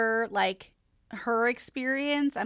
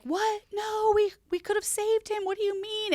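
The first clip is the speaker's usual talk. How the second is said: retold screaming; 12 semitones higher than usual. Kids hearing their brother died, high shocked cries. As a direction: cry out in shocked protest, voice jumping up. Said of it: "They screamed".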